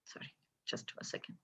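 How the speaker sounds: real whisper, stepping away briefly; whispered aside. Real, not acted. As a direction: breathe it as a quick apology off to the side. Pauses the story to step away.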